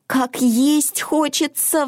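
slow, high, plaintive little complaint; sighing. A narrator's reading. Hungry Mona heading into the forest, sighing. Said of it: "вздохнула Мона".

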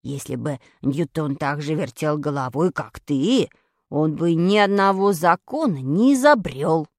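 very low, measured character voice; decisive Vadik. A narrator's reading. Vadik lecturing about Newton, low and decisive. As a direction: lay it down firmly in a deep voice. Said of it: "решительно сказал Вадик".

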